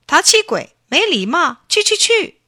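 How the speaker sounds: high, loud sing-song imitation; boy mimicking the echo to grandma; mock echo voice. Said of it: "他装腔装调的也说".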